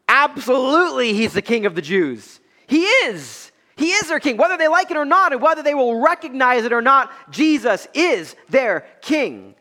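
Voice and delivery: high-pitched, insistent proclamation; impassioned preacher, slowing hard at the end; emphatic preaching. Acted. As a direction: declare it from the pulpit without a doubt. Preaches Jesus as king.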